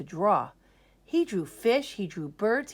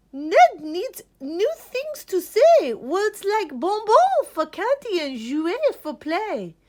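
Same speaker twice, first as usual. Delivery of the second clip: much higher and louder, slow start; loud high French teacher; 12 semitones higher, 8 dB louder than usual. Acted. French teacher prescribes new French words, loud and high. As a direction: sing out like a showy French teacher. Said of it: "the French teacher said".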